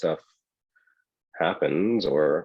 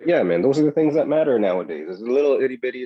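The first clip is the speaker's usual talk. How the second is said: loud raised emphasis; emphatic; 6 semitones higher, 6 dB louder than usual. Real, not acted. Host hammers home what matters to cohost, loud and raised. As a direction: drive the point home loudly. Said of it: "emphatic point".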